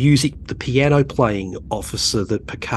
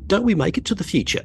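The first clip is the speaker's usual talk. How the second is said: higher-pitched imitation of a curious teenager; teenage son; 9 semitones higher than usual. Son asking his dad about Australians in the future, pitched-up teen imitation. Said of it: "he said".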